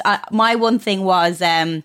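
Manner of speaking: normal talking voice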